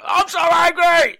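high, loud, drawn-out caller's yell; hammed-up enraged bellow; Mister Angry. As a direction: imitate a furious phone-in shouter. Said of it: "Mister Angry was a character who'd call up the show".

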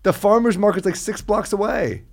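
loud, high-pitched indignant complaint; pissed off. Real, not acted. Friend still resenting being asked to fetch fruit, pissed off. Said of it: "I'm still pissed off about that".

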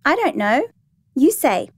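put-on high child's voice with swooping pitch; little girl. A narrator's reading. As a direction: answer like a small child. Little girl answers in play.

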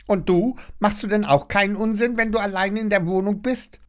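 high, quick chattering busybody; chattering neighbour. A narrator's reading. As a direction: rattle on fast like a nosy neighbour. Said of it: "schnattert Frau Schnattermann weiter".

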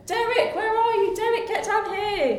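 high, loud calling out; yelling across the room. Acted. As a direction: call up to someone far away. Calling up to Derek.

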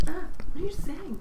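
high baffled question; confused. Retold or imitated. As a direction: ask it totally lost. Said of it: "and I'm like".

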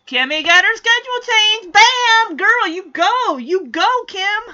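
high, loud cheering. Real, not acted. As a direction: cheer her on loudly. Cheering for Kimmy.

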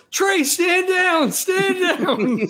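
drawn-out, high-pitched order; acted yell. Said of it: "Nash yells".

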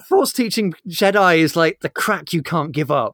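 ordinary talk

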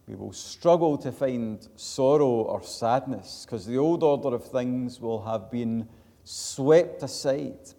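loud, ringing pulpit delivery; full-voiced sermon proclamation; forceful preaching. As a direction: preach it out to the whole congregation.

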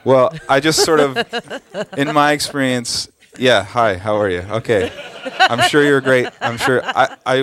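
flustered laughing. Real, not acted. Guest backpedaling after insulting host's boss, laughing loudly. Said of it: "My boss is here".

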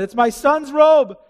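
lightly voiced Jacob's lament; grieving father. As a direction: cry over the torn robe.

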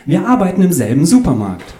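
high, sing-song sweetness; fluting. A narrator's reading. Andrea boasts of their shared workplace, high and fluting. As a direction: flute it out high and lilting. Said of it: "flötete Andreas".

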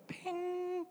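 high, ringing ping noise; a mind going ping; imitated ping. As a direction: make a little high-pitched ping, held on the end. Imitates disciples' minds going ping.

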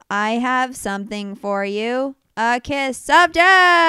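slow line building to a loud, high finish; hammed-up dramatic movie delivery; mock movie actress. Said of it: "she's just like".

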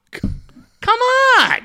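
high, breathy, whining come on; whiny exasperation. Real, not acted. Fan groaning at a radio host he hates, high exasperated whine.

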